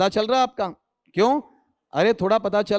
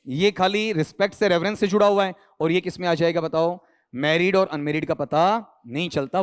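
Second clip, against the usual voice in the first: ordinary delivery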